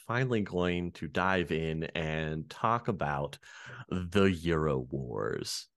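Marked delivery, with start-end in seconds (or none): 2.5-5.7 low, slow dramatic announcement; ominous reveal. Acted. Host teasing the topic to listeners, low and slow. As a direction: drop into a doom-laden tease.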